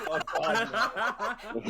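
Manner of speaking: high, loud, laughing cry; laughing mock grief. Mock grief over the victory.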